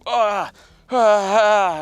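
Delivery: loud, high imitated agony cry; loud hammed-up groans of pain; mock pained cry. Second cameraman's high, loud mock cry after snorting.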